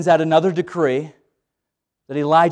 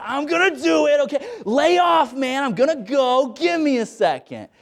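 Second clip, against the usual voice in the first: high, jittery stalling; nervous Peter; 8 semitones higher than usual. Acted. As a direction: put them off while working up nerve.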